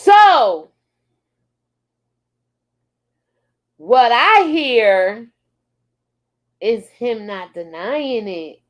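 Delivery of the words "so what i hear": Tommy Sotomayor. Acted. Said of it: "In my Tommy Sotomayor voice".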